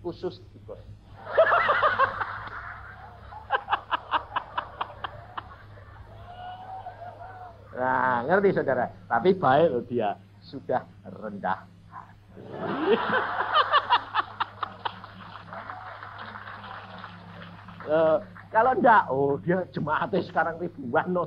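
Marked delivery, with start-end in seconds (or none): 0.8-7.7 drawn-out laugh up in a high register; long high laugh. Real, not acted. Preacher laughing at the pastor's faithful visits, long high laugh. 12.5-17.8 high, breathy chuckle; airy laugh. Real, not acted. Preacher laughing about the humble rich pastor, airy laugh.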